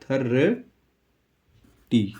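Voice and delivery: थर्टी drawn out slowly, each sound clear; teacher stretching थर्टी for students to copy; slow model pronunciation. Shown as a demonstration. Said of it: "इसको बोलते हैं थर्टी".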